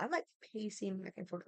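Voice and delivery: normal talking voice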